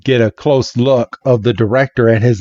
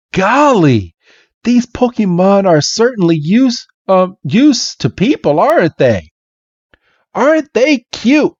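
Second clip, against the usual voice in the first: gushing admiration; 10 semitones higher than usual. Retold or imitated. Club members admire the Pokemon.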